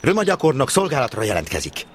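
high, crisp report that falls at the end; military reporting. Acted. Trainee at attention before the stationmaster, military reporting. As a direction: report for duty like a recruit.

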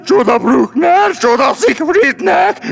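hammed-up wail building to a high cry; melodramatic lament. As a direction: mourn yourself grandly, rising to a shout. Lion laments himself theatrically.

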